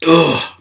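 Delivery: loud groan of pain. Real, not acted. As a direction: groan as the cough hurts. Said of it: "it hurts".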